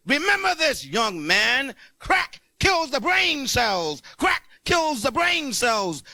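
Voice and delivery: booming, slow high warning to a student; mock-preaching warning about crack; stern warning. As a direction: drill it into him like a stern principal. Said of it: "and I said".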